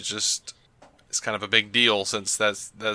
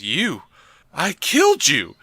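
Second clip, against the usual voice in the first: shocked Joker; 5 semitones higher than usual. Retold or imitated. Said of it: "stares at him with mouth agape and goes".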